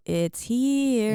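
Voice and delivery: lilting high, stretched-out movie quote; sing-songy quote. Retold or imitated. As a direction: imitate an eerie sing-song announcement. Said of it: "It's the same sing-songy voice".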